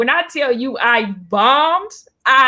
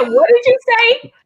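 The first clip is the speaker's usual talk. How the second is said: much higher, louder indignant demand; indignant DeRay; 12 semitones higher, 6 dB louder than usual. Retold or imitated. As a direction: retell his shocked, indignant demand.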